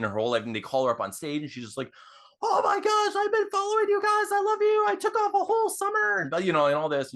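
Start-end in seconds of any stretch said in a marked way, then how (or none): 2.4-6.2 very high, loud fangirl squeal; freaking-out fangirl. Acted. Fan called up on stage by her band, freaking out. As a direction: gush breakneck in a hammed-up squeal. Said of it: "she starts freaking out".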